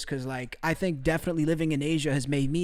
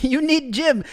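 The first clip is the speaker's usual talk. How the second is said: louder, higher blunt you need gym; mock broken English; 8 semitones higher, 7 dB louder, slower than usual. Repeating ex-girlfriend's catchphrase to chat, louder and higher. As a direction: bark the ex's catchphrase. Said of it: "I want one, at least one person to say".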